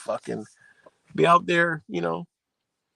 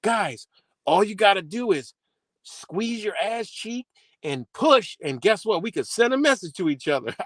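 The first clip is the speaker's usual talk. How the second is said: higher, hyped-up discovery voice; excited inventor; 8 semitones higher than usual. Pretend. Future genius telling his friends, excited and higher. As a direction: announce it like a breakthrough. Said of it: "And we're like".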